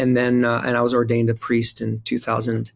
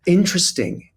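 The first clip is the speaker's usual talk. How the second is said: mock a Southern listener politely hiding her doubt; 7 semitones higher than usual. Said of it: "Southern way of saying".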